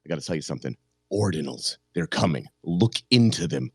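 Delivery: a hint of a low, ominous prophet; ominous warning. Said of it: "he said ominously".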